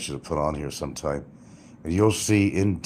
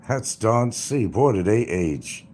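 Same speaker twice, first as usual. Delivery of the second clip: usual speaking voice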